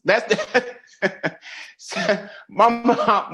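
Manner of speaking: breaking up laughing mid-sentence; real laugh bursting through the words; can't get it out for laughing. Real, not acted. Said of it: "I'm laughing now because it is funny".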